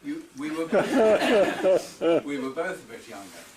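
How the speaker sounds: laughing. Real, not acted. Laughing at heckle about age.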